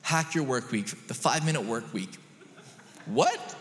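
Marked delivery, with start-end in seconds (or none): 3.1-3.6 high-pitched, baffled "what?"; incredulous. Real, not acted. Preacher to congregation about absurd book titles, incredulous. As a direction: react in sheer disbelief. Mocking five-minute work week book title.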